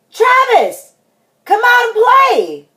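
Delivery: high, loud holler to a friend; high-pitched calling shout. Acted. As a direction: call out for a playmate at full voice.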